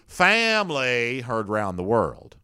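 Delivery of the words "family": drawn-out mock Louisiana drawl; imitating a badly faked Louisiana accent; fake Southern accent. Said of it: "faked a Louisiana-style Southern accent".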